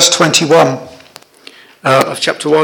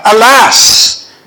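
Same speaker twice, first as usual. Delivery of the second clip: loud prophetic cry, pitched up; shouting out; 6 semitones higher, 5 dB louder than usual. Pretend. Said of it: "coming out on the platform and shouting out".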